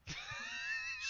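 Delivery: high shrieking laugh. Real, not acted. Failed transformation, burst of laughter.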